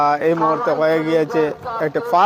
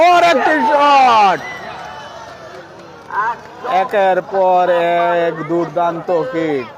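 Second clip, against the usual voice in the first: frantic football commentary; much slower than usual. Real, not acted. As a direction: drag out the shot call in a roar. Another shot, commentator shouts.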